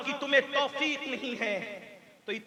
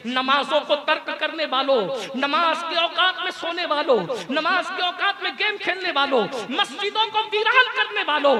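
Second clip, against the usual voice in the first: rising, louder call to the negligent; full-force preaching; 6 semitones higher, 9 dB louder than usual. Real, not acted. Preacher rebuking those who skip prayers, fervent rising call. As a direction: build each address higher into a loud rebuke.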